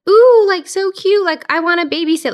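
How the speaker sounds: high-pitched cooing over babies; very high, loud mock swoon; mock gushing. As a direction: squeal it like a baby-crazy fan. Mimics gushing over babies she never did.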